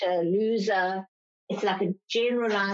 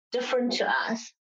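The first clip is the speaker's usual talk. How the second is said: usual speaking voice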